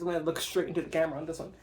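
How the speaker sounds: normal talking voice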